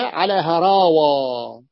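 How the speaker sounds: "على هراوة" very slow, long vowels held; drawn-out plural. Shown as a demonstration. Teacher answering how it is pluralised, drawn out. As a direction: stretch "هراوة" right through to its last vowel. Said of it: "تجمع على ماذا؟".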